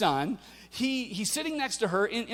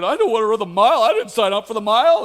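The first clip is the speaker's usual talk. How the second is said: imitating a frustrated daughter, louder and quick; 2 semitones higher, 11 dB louder, faster than usual. Daughter protesting she never signed up, frustrated whine. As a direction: whine it like a kid who won't run. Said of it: "she was frustrated".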